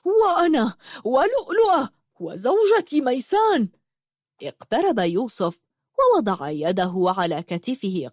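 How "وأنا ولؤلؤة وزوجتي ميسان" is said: high-pitched, indignant cry; acted outraged shout; angry shout. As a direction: cry out in angry protest, voice far higher. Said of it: "صاح بركات غاضبا".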